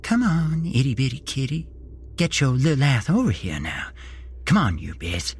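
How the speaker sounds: high, lisping sing-song; Mike Tyson lisp. A narrator's reading. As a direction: coax the kitten with a childlike lisp. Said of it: "said with a Mike Tyson lisp".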